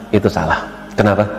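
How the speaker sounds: ordinary talk, nothing marked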